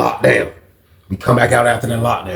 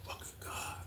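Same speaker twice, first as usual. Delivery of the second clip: barely audible whispered oath; hushed, voiceless reaction; stunned whisper; 33 dB quieter than usual. Retold or imitated. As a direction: whisper it to yourself in disbelief. Retelling his stunned reaction.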